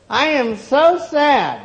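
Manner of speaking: put-on high, slow, loud whiny girl; girl complaining. A narrator's reading. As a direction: stretch it out like a pleading child. Girl tells shopkeeper she's sad.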